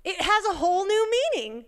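high-pitched, emphatic delight; retold excitement. Speaker retelling her friend's discovery, high and excited. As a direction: imitate her thrill at a discovery. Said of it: "she's so excited".

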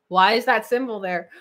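usual speaking voice

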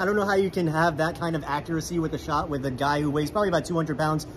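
normal talking voice

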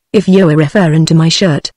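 ordinary delivery

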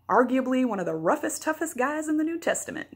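slipping into a Southern twang, pitch climbing; Southern accent. Real, not acted. Preacher describing the Apostle Paul, accidentally turning Southern. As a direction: let a Southern accent creep in, high on the end. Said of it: "I got kinda Southern there".

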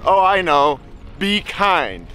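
ordinary talk, nothing marked

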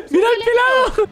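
squealing high through laughter; laughing hard. Real, not acted. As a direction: crack up while pointing him out. Laughing hard at a bald player. (riéndose a carcajadas)

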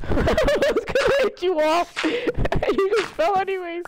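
high, slow, laughing through words; teasing laugh-talk. Real, not acted. Laughing at friend falling.

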